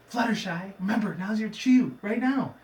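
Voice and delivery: a friend prompting the shy pony to act; urgent Twilight. Retold or imitated. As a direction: hiss the cue with mock urgency. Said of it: "she's like".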